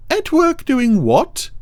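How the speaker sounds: very high, slow cow voice; campy drag queen. A narrator's reading. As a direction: drawl it sweetly and high. Ermintrude voiced.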